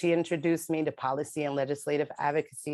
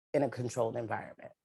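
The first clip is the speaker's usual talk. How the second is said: ordinary talk, nothing marked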